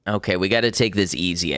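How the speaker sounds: ordinary delivery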